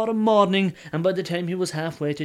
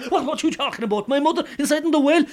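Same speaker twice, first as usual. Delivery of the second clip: higher, fast mock shock; feigned alarm; 10 semitones higher, 4 dB louder, faster than usual. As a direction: put on frantic surprise. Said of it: "who pretended to be all action".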